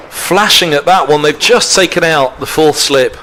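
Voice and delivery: ordinary talk, nothing marked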